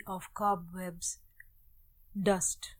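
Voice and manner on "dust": usual speaking voice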